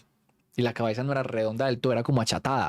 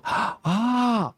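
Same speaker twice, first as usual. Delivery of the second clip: high, put-on gasp of surprise; fake surprise; 10 semitones higher than usual. Narrator faking shock for his scared friends, high fake gasp. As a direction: pretend to be amazed. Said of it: "me hice el sorprendido".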